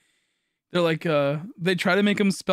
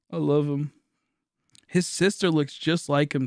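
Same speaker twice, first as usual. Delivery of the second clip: ordinary delivery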